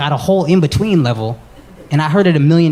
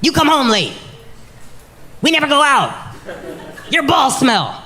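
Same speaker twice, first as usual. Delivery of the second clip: high nagging complaints; lightly voiced girlfriend nagging; 8 semitones higher than usual. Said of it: "Women get upset every hour".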